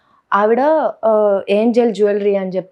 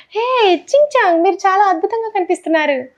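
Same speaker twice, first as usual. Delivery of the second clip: sing-song coo, as a mother to her little one; baby talk; 11 semitones higher, 4 dB louder than usual. Pretend. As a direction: talk like you are cooing to a baby. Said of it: "ఇట్లాంటి అలవాటు అయిపోయినాయి".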